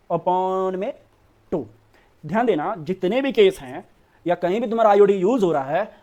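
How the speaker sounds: ordinary talk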